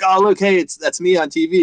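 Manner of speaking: high, eager show-off; excited dad. Retold or imitated. Dad excited to show his son the TV, high and eager. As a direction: point it out with bright eagerness. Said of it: "I'll be like".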